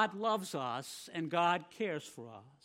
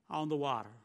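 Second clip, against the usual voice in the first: ordinary talk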